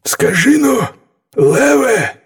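high-pitched storybook wolf; high, prodding wolf character. A narrator's reading. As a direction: call out to the lion in a high animal voice. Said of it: "першим обізвався вовк".

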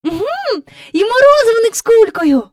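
high, loud excited cry; girl calling out. A narrator's reading. Jane spots the ice-cream man, calling out excitedly. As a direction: pipe up loudly like an excited girl. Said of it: "гукнула Джейн".

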